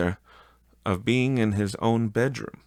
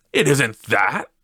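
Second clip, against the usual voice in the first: heated; 5 semitones higher, 6 dB louder than usual. Acted. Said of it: "heated by the remark".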